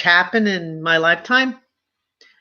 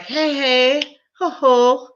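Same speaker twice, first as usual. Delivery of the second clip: higher hearty greeting; grandpa's cheery call; 7 semitones higher, much slower than usual. Retold or imitated. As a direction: sing it out like a jolly grandpa. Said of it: "my father's walking in like".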